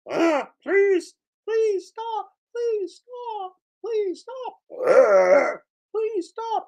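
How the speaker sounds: high squeaky pleading whine; mock whine. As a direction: beg in a tiny squeaky voice. Squeaky mock-whine, Always Sunny joke.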